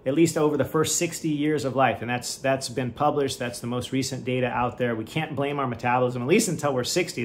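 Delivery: ordinary delivery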